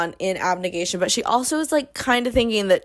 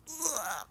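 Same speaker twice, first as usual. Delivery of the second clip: cringing groan; 4 semitones higher, 14 dB quieter than usual. Real, not acted. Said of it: "Don't even look at me".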